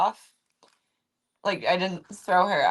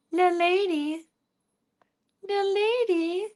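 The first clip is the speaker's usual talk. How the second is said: "The lady" in a much higher squeaky pet voice; cooing "The lady" sing-song; high cat-talk voice; 10 semitones higher than usual. Shown as a demonstration. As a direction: pitch "The lady" way up like baby talk.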